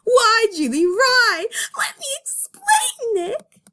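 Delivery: high tearful pleading, voice breaking; Judy crying. Acted. Judy pleads tearfully with Nick, high crying voice. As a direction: beg him through tears, high and strained.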